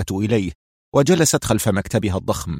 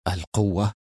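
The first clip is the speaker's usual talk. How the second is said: much softer, awed echo of القوة؟; hushed, lower trembling القوة؟; shaken echo; 3 semitones lower, 7 dB quieter than usual. Acted. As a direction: whisper back القوة؟ with a shiver. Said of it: "ارتجفت لها الدماء في عروقه".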